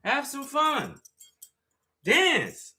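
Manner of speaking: high mocking voice egging him on; high teasing party voice; mocking the pushy friends. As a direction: ape the friends nagging him to dance. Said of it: "They be pressuring me to dance".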